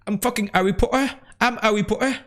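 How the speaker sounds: high-pitched mock English accent; accent anglais. Shown as a demonstration. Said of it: "mon accent anglais aussi". (faux accent anglais, voix haut perchée)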